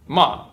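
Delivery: "Ma" short, high and falling sharply; "Ma" loud with a sudden fall; fourth tone. Shown as a demonstration. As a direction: drop "Ma" hard from high. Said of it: "called a falling tone".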